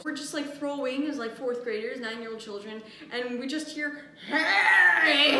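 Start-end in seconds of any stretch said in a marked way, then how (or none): 4.3-5.4 loud, higher-pitched holler; angry shout. Retold or imitated. Old hoarder calls out to kids at practice, angry shout. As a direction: bellow it across a yard.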